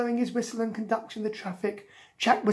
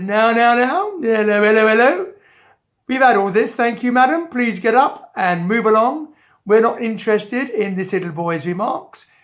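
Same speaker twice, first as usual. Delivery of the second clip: loud comic bobby voice; officious policeman; 3 semitones higher, 7 dB louder than usual. Acted. Policeman telling madam to move along, officious. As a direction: brush her off loudly and pompously.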